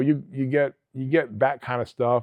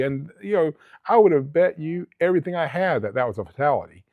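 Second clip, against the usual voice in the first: normal talking voice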